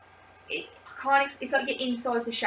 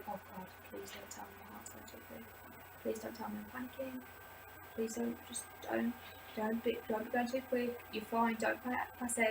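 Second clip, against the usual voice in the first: frightened whisper; 11 dB quieter than usual. Retold or imitated. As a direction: beg your heart under your breath. Pleading with her racing heart.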